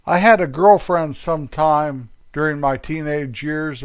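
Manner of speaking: usual speaking voice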